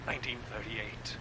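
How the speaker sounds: ordinary delivery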